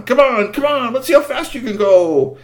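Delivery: high, loud urging of the horses; eager urging. Acted. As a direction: egg the team on, thrilled.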